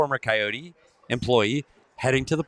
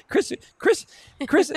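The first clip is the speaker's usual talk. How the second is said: high, sing-song call of a name; calling Chris; slower than usual. Real, not acted. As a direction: call out to her across the room, playful. Host calls out to chat member.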